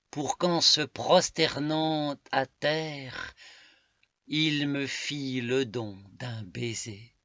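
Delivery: emphatic, deliberate poetic declamation; poem recitation. Acted. Reading a love poem to listeners, emphatic recitation. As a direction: declaim it phrase by phrase, leaning on key words.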